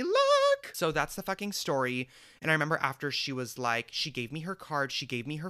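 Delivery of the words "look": very high, loud squeal; triumphant outburst. Real, not acted. Boasting he became the face of Disney look, triumphant squeal.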